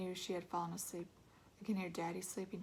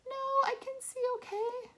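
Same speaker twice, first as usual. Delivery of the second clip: mom voice; 4 dB louder than usual. A narrator's reading.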